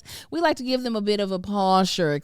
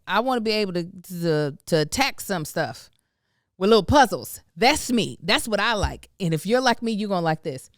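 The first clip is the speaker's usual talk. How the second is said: normal talking voice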